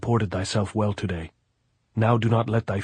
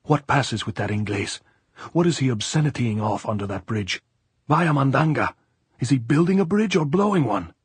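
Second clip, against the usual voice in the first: anger building to high, loud indignation; violent raging; 5 semitones higher, 4 dB louder than usual. A narrator's reading. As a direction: rage harder with each question, climbing in pitch and volume. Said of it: "Then she said violently and raging".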